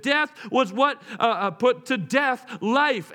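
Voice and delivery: normal talking voice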